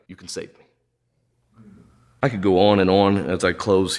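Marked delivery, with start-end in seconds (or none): 0.1-0.6 whispered plea. Retold or imitated. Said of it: "So you know what he says?".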